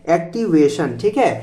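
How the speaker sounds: ordinary talk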